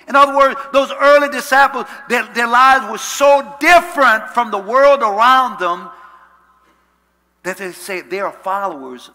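very loud, high-pitched sermon surge; full-force preaching. Real, not acted. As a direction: drive the point home at full voice.

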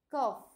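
loud "cough" ending in an F. Shown as a demonstration. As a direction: say "cough" as coff. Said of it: "se pronuncia como una F".

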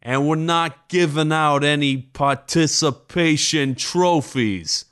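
high, slow sneering comedian; hammy stand-up delivery; hack comic voice. Retold or imitated. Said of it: "It's like".

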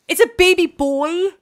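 high, loud joyful announcement; excited shout. Real, not acted. Announcing the baby boy to her viewers, loud and thrilled. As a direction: burst out with the big news.

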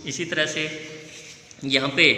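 ordinary talk

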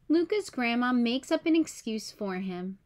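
ordinary delivery, nothing marked

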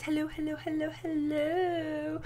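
high, drawn-out hellos; sing-song hellos. Real, not acted. Greeting her viewers, a high sing-song hello. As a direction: stretch each hello up high and slow.

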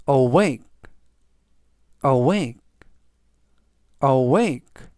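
awake repeated clearly, falling; model pronunciation. Shown as a demonstration. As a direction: say awake clearly with a fall for learners. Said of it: "라고 발음하시면 되겠고요".

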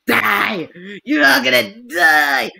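loud, drawn-out threatening shout; battle yell. Pretend. Gamer threatening enemies in a shooter, yelling. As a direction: yell it at the enemy, dragging it out.

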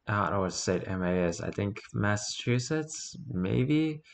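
ordinary delivery

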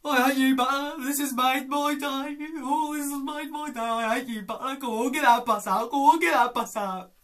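loud, high shouting inspector; Blakey impression. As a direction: bellow at Butler like Blakey. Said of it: "it's Blakey".

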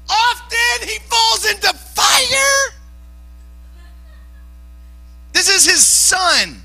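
very high, slow, drawn-out cry; preacher at full pitch driving it home; impassioned shout. Real, not acted. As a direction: shout it slowly, stretching each word. Shouts the father's dire situation.

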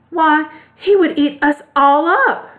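high, slow alarmed mouse queen; crying out. A narrator's reading. Mouse queen fears the lion, crying out. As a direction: protest in a high, drawn-out little cry. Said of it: "cried the little Queen".

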